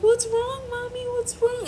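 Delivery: mother mimics her toddler at the ultrasound. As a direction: imitate a worried toddler. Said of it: "they're like".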